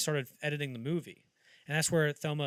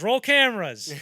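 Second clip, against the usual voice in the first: loud, higher director's command; barked order; 9 semitones higher, 16 dB louder, slower than usual. Retold or imitated. Friedkin ordering the crew, loud mock shout. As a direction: yell it like a director on set. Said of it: "And he goes".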